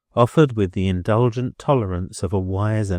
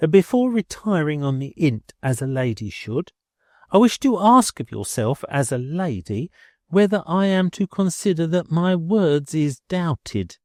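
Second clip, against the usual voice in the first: higher, stiffly polite landlady voice; courtly landlady; 8 semitones higher than usual. A narrator's reading. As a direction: put on airs with mock courtesy. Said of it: "in a courtly manner".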